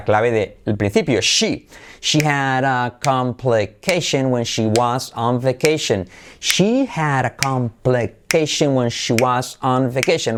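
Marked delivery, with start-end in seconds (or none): 2.0-10.4 slow, stretched-out teacher delivery; measured pronunciation drill; slow model sentence. Shown as a demonstration. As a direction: draw each word out for learners to repeat. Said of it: "La frase de referencia".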